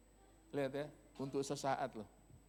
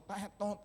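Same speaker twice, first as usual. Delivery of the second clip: high imitated car horn; tiruan klakson; 7 semitones higher than usual. (tiruan bunyi klakson)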